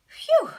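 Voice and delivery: loud high sigh of relief, blown out; boy waking from a falling dream, relieved; relieved phew. Acted. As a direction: let all the fear go in one big phew. Walter relieved after the dream.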